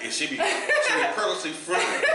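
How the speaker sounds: teasing through laughter; laughing tease. Real, not acted. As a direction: accuse them, cracking up. Teasing his co-host, laughing.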